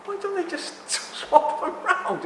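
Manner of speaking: high, slow, laughing through it; real giggle breaking the words; laughing question. Real, not acted. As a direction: laugh as you ask it. Laughs at his own question.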